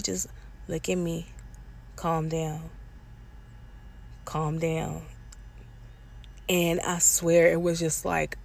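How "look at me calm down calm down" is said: soft, steady retold calm-down; quiet calming. Partner telling her to calm down, soft and steady.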